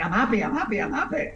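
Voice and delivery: cartoonishly cheerful; mock happy person. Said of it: "They're like".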